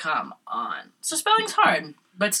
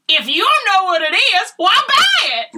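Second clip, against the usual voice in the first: high loud twangy vendor voice; farmer guy voice; 14 semitones higher, 7 dB louder than usual. Retold or imitated. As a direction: squawk it like the pushy vendor. Farmer's market guy retold.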